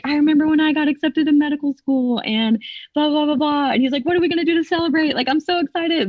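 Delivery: high, fast excited gushing; excited doctor. Retold or imitated. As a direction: gush like an overjoyed doctor. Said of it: "he was like".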